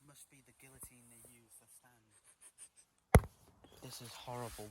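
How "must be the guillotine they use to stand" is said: hushed whisper in a grim place. Real, not acted. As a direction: whisper it so nobody hears. Creeping through grim scene.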